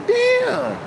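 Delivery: high, drawn-out shocked damn; imitating a stunned listener. They react to Putin's reveal.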